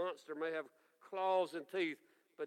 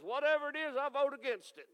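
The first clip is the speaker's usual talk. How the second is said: grumpy church member; 9 semitones higher, 5 dB louder than usual. Retold or imitated. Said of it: "somebody jumps up and says".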